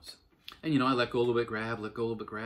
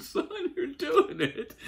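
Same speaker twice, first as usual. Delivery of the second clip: high, slow, laughing yell of pride; dad proudly cheering his son on the bike, yelling through laughter; 4 dB louder, much slower than usual. Retold or imitated. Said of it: "I yelled".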